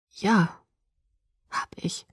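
voiceless, barely there; whispered admission. A narrator's reading. Bella confesses to Edward in a whisper. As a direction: let the confession fall to a whisper.

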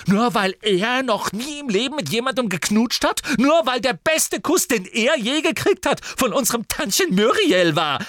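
mock him with fury; furious mockery. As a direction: sister taunting her brother, furious. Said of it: "fuhr sie nun Harry an".

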